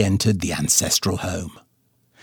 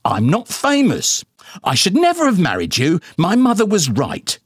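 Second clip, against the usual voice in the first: high-pitched, huffy mother voice; indignant mama; 9 semitones higher than usual. A narrator's reading. Mama bickering with daddy about his bald spot, high and huffy. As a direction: sniff it out, much higher, prim and offended. Mama's bitter retort.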